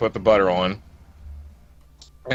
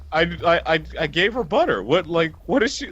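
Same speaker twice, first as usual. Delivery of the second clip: higher, rushed and bewildered; flustered disbelief; 6 semitones higher than usual. Retold or imitated. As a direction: stammer it out, baffled and pitched up. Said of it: "I was like".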